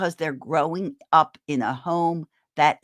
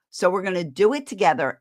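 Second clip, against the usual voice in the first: ordinary talk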